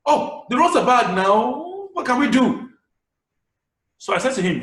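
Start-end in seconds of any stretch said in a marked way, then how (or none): 0.1-2.7 higher, louder, slow lament about the roads; a fed-up man lamenting the bad roads, higher, louder and slow; dramatized complaint. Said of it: "narrated that same story with the effect of drama".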